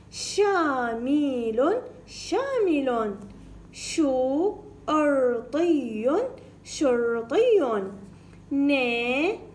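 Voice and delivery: "شامل" stretched, split into slow syllables. Shown as a demonstration. Teacher reading by syllables for pupils, slow. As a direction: spell it out slowly in syllables. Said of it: "بالطريقة المقطعية".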